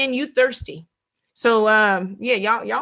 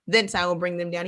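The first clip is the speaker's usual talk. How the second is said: slow, weighty verse reading; preacher slowly reading a warning verse; solemn scripture reading; 2 semitones lower, slower than usual. Acted. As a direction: read each phrase slowly, letting it land.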